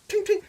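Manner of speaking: two very high "ting" sounds; ping it out high, twice; imitated ting. Gamer showing off fast ammo, very high imitated ting.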